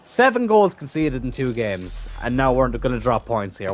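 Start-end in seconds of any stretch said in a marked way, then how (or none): none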